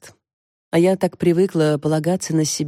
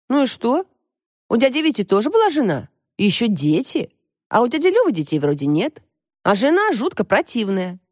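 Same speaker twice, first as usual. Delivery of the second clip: higher-pitched daughter's voice; pitched up, pressing her case; insistent young daughter; 6 semitones higher than usual. A narrator's reading. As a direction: argue back like a stubborn child. Narrator voices the daughter arguing.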